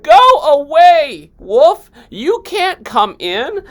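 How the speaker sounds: high, loud, slow yell; yelling back. Acted. Second pig yelling at the wolf through the door. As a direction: shout him away from the door. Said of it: "He yelled back".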